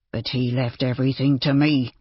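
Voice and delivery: ordinary delivery, nothing marked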